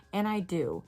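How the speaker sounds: ordinary delivery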